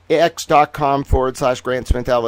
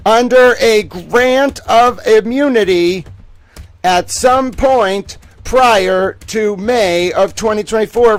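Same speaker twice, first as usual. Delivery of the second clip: slow, loud, higher mock news anchor delivery; hammed-up announcer dropping a bombshell, word by word; breaking news read; 7 semitones higher, 7 dB louder, slower than usual.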